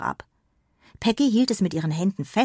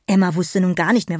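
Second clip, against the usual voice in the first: usual speaking voice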